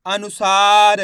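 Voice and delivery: अनुसार loud, with a long held सा; syllable-by-syllable reading. Shown as a demonstration. Teacher blends letters for learners, loud syllabic अनुसार. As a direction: sound out अ-नु-सा-र emphatically. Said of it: "एक एक अक्षर का उच्चारण".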